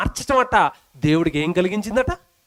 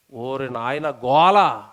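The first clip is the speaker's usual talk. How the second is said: exasperated; 7 semitones lower, much slower than usual. Real, not acted. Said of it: "exasperated aside".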